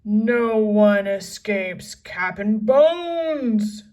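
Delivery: loud, slow gruff pirate voice; pirate roar. A narrator's reading. Captain gloating over his captives, slow loud roar. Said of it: "he roared".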